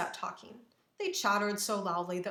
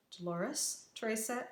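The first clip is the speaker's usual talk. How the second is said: ordinary delivery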